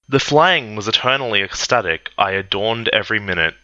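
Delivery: ordinary delivery, nothing marked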